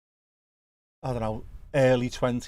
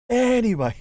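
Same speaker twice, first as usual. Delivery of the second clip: loud sing-song anyway; 11 semitones higher, 7 dB louder than usual. Pretend. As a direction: belt out the anyway to change topic. Loud high comic reset.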